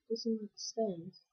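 usual speaking voice

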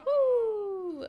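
loud high cheer. Real, not acted. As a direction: whoop for joy. Celebrating the wedding.

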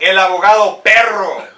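loud, punchy Spanish nickname intro; hyped announcement. Pretend. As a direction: belt it out like a ring announcer.

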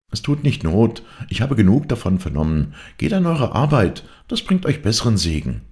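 high, firm housewife's voice; scolding mistress. A narrator's reading. Mistress orders gossiping maids to work, high firm rebuke. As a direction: send them off to work, pitched high. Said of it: "sprach diese".

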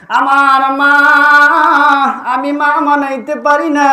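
high, loud mother's lament; acted melodic wail; anguished cry. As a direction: cry out with stretched vowels. Mother cries out to prophet.

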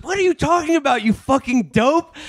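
high-pitched mock outrage, slowing on the insult; imitated fury. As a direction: act out a furious comeback.

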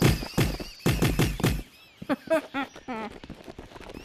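big helpless burst of laughter; loud nervous laugh. Real, not acted. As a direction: laugh out at impending death. Laughing at own doom.

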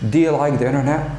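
quick relaxed "you" as "ya", run together; fast reduced you. Shown as a demonstration. Teacher repeating at natural fast speed. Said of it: "you, if not stressed, is pronounced yo".

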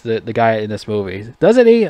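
normal talking voice

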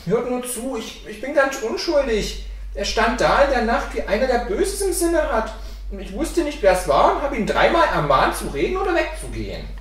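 high, earnest self-defence; protesting innocent boy. A narrator's reading. Boy explains himself to his angry father, high protest.

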